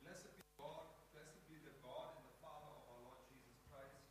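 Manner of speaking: distant reading of scripture from across the room; faint voice reading the Bible; far-off reader. Real, not acted. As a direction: read it as if from the back of the hall. Congregant reads scripture aloud.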